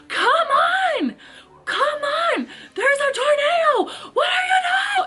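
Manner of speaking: high, loud, frantic yelling near tears; panicked shout. Retold or imitated. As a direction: yell at your family to hurry, voice cracking with panic. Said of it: "And I'm like".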